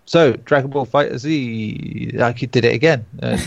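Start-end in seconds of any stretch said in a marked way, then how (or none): 0.1-2.2 FighterZ slowly drawn out as Fighter Zee; slow, deliberate Dragon Ball Fighter Zee; all caps reading. Shown as a demonstration. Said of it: "I can't not read it like that".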